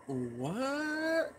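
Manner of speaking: high, drawn-out questioning what; puzzled. Retold or imitated. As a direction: stretch it upward in mock bewilderment. Puzzled thought while sitting.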